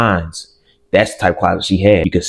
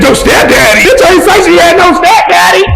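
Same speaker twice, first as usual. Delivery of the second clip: shocked shout; 8 dB louder than usual. Retold or imitated. Shocked outburst at stepdad news.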